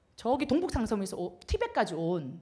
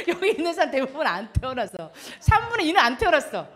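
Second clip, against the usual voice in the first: usual speaking voice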